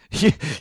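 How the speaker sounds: a quick burst of real laughter; short laugh. Real, not acted. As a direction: laugh at yourself, brief and bright. Laughing at own prayer argument.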